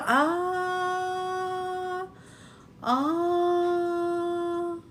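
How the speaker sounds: ordinary talk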